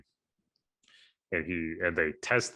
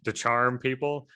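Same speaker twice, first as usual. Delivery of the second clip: usual speaking voice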